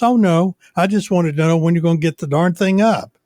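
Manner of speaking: high-pitched imitation of the lady; homeowner association lady. Association lady reassuring a worried antenna owner, high put-on voice. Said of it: "that's exactly how she sounds".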